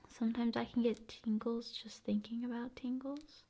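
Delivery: light whispered musing; ASMR whisper. Acted. ASMR host whispering to viewer about tingles. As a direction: whisper it like a secret.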